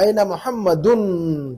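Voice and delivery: slow मोहम्मदुन, holding the final "-dunn"; मोहम्मदुन with a lingering, humming final n; final vowel made clear. Shown as a demonstration. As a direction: stretch the "un" at the end of मोहम्मदुन for learners. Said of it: "जबर जेर पेश को जाहिर कीजिए".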